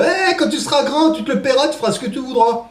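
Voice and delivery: high nagging parent voice; scolding parent. Retold or imitated. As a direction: lecture the kid in a high voice. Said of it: "je me faisais défoncer par mes parents".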